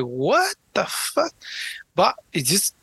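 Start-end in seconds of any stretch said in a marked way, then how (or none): 0.0-1.3 stunned. Retold or imitated. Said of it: "you're like".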